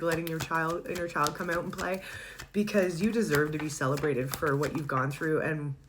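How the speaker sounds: ordinary talk, nothing marked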